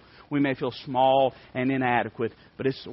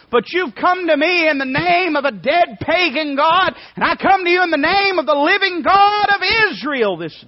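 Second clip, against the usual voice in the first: preaching at full force; 14 semitones higher, 10 dB louder than usual. Acted. Preacher voicing David defying Goliath, full shout. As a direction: shout it like a revival climax.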